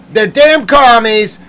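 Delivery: high, loud accusation; all mad. Real, not acted. Accusing the leakers of secrets, mad. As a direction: burst out in exasperation. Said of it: "I'm all mad".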